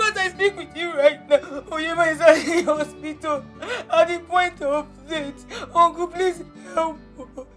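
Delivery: crying softly while pleading; quiet sobbing report to uncle; tearful plea. Acted. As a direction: choke through the bad news in tears. Telling uncle of the accident.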